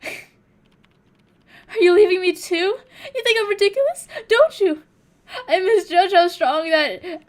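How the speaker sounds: high, loud, slow wailing sob; overdone fake crying. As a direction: ham up the crying, begging not to be left. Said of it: "I'm not good at, like, fake crying".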